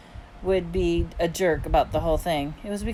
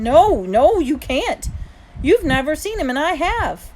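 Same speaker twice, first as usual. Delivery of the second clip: higher, louder protest; insistent contradiction; 8 semitones higher, 7 dB louder than usual. A narrator's reading. Son contradicts mom about the kid, insistent. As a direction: cut in and insist.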